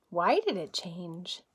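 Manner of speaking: plain reading voice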